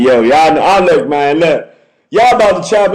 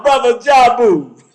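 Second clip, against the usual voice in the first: ordinary talk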